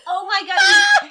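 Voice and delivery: loud, high, drawn-out cry of shock; shocked outburst. Real, not acted. Co-host reacting to a movie villain, shocked shout.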